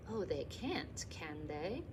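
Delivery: soft, wry murmur under the breath; quiet knowing aside; murmured to herself. Acted. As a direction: mutter it just for herself, amused. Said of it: "murmured Charlotte to herself".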